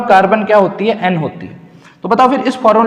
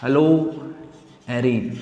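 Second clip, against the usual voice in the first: very slow, words drawn out with long gaps; slow dictation; 4 semitones lower, 3 dB quieter, much slower than usual. Real, not acted. Teacher writing the topic for students, slow dictation. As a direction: say each word slowly as if writing it.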